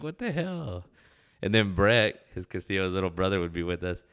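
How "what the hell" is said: mock startled. Startled customer's reaction, acted.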